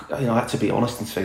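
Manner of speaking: ordinary delivery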